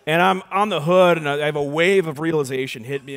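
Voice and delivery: ordinary delivery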